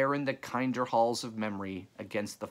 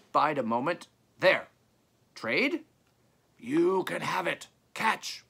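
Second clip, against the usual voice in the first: high, raised trader's call; calling across; 7 semitones higher, 4 dB louder than usual. A narrator's reading. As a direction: pitch it up to carry across a distance. Trader calls back across graveyard.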